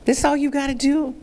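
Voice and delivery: a touch of incredulous wonder; retold disbelief. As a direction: recall your own surprise, lightly acted. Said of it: "and I said".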